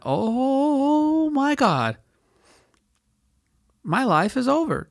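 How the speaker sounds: high, stretched-out wail of panic; slow falsetto panic; panicked high squeal. Retold or imitated. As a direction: squeal it like your life just ended. Panicked realization, cop at window.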